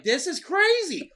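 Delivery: slow, high drawn-out amazement; amazed. Retold or imitated. Watching the crash video, slow high amazed drawl. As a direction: stretch it out in disbelief.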